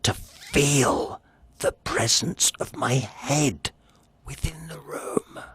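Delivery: slow, fading into a whisper; eerie whisper. A narrator's reading. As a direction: let it die to a breath. Dread builds in Poe narration.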